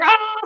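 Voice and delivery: high-pitched re-enacted "ah!"; startled scream. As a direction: imitate a panicked little scream, well up in pitch. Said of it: "So I was like".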